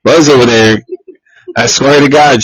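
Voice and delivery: usual speaking voice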